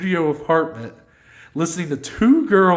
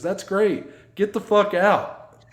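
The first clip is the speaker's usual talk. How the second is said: bright fake cheer turning into a slow, higher snarl; mock-angry kick-out; mock angry driver; 6 semitones higher, slower than usual. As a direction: play the driver losing it. Angry Uber driver kicks them out.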